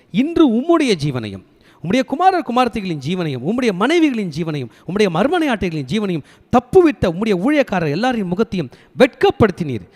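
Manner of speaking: loud, high recited reproach; stern rebuke. Acted. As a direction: pile up the charges forcefully, as a commander rebuking his king. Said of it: "தாவீது ராஜாவை கண்டிப்பதற்காக". (கண்டிக்கும் தொனியில் உரக்க வாசித்து)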